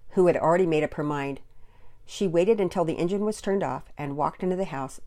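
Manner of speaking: ordinary delivery, nothing marked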